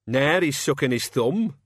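taunting, gleeful needling; jeering. A narrator's reading. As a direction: jeer like a playground bully. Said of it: "Cat's Eye jeered".

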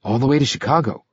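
quick, light youthful voice; a shy kid answering fast; young hitchhiker voice. A narrator's reading.

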